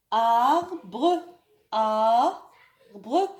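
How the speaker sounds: "Arbre" called out loud with a lifted end; loud drilled word. Shown as a demonstration. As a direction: project "Arbre" for the class to repeat. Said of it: "ما معنى arbre". (نطق arbre بصوت عالٍ وواضح)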